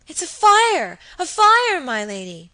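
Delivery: high, loud, frightened cry of fire; panicked alarm. Acted. Phoebe warning her lady of the fire, panicked shout.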